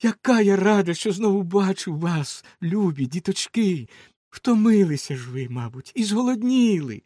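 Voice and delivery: joyful mother. Acted. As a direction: greet the children with relief. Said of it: "казала вона". (радісний голос матері)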